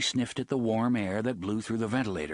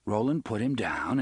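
ordinary delivery

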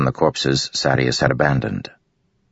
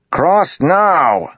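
sharp high loud command; yelling; 6 dB louder than usual. A narrator's reading. Matal yelling at the bridge crew to cross, loud order. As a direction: bark the order at them. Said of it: "Matal yelled".

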